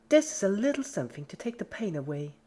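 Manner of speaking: high, sweet menacing voice; sugary threat to a victim; creepy cheerful Pinkie. A narrator's reading.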